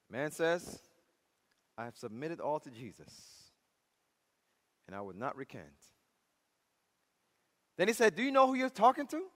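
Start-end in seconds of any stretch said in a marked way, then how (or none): 1.8-5.8 low, soft, steady refusal; hushed, low-voiced convert standing firm; quiet resolve. Convert calmly refuses judge. 8.1-9.3 acted judge, high and quick; mock outrage with raised pitch; indignant challenge.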